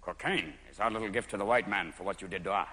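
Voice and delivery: deep, low solemn statement; solemn chief. Retold or imitated. Peruvian chief speaking to the camera, deep and solemn.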